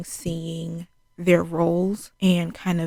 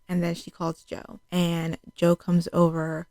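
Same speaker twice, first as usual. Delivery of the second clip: ordinary delivery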